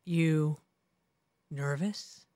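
imitating a low, quiet put-on voice; deep teasing voice. Retelling the prank on the new anchor, in a deep voice. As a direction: drop the voice low and soft to needle him. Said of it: "he said".

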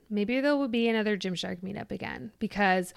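usual speaking voice

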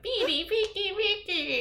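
put-on high cackling old hag; old witch voice. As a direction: squeak it out like a witch. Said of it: "using an old witch voice".

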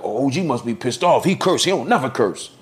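high surprised voice; imitating people. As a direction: pitch up, amazed gossip. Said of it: "it's like".

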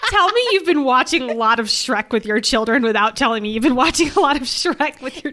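cracking up as she teases her co-host about Shrek; laughing tease. Real, not acted.

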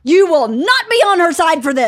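loud, high outraged order; retold screaming. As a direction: imitate a dad wailing in indignation. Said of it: "Everybody here is screaming".

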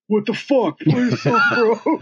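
high-pitched mock audience heckle; heckling crowd. Singer mimics the crowd demanding a song, mock indignant heckle. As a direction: imitate an impatient fan calling out, half laughing. Imitating the baffled, impatient crowd.

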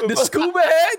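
asked high through laughter; high, giggling disbelief; laughing question. Real, not acted. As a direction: laugh while repeating it. Repeating the phrase mid-laugh.

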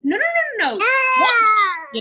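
a fake cry begging to be wanted; hammed-up sobbing plea; mock whining plea. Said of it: "you're just like".

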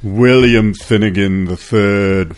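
slow, stately mock-aristocrat name; mock upper-crust. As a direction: announce the name with drawn-out mock grandeur. Mock upper-crust title after Pulitzer joke.

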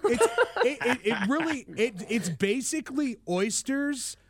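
talking through laughter; laughing. Real, not acted. Guest laughing at the seawater joke while explaining. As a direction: get the words out while still laughing.

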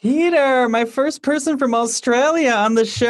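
high and loud thrilled greeting; light up for a special guest; real excitement. Real, not acted. Host welcoming first Australian guest, real high excitement.

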